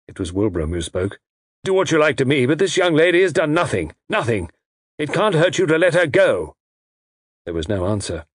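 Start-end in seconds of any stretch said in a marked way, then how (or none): 1.6-6.5 higher, emphatic plea for her release; indignant, pitched-up pleading; urgent protest. A narrator's reading. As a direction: protest forcefully on her behalf. Captive pleads for young lady.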